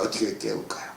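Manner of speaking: 어떻게 깨울까요 whispered, soft and breathless; 어떻게 깨울까요 as a quiet whispered echo; whispered repeat. Shown as a demonstration. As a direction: drop to a hushed whisper for the repeat. Preacher repeats his question softly.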